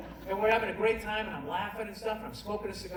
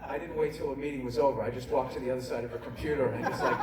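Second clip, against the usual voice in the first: ordinary delivery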